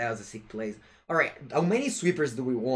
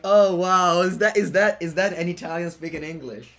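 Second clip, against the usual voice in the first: loud, higher-pitched mock astonishment; retold amazement; 5 semitones higher, 8 dB louder than usual. Streamer retelling hearing an Italian speak English, acted amazement. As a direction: re-enact your stunned reaction, louder and higher. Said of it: "I was like".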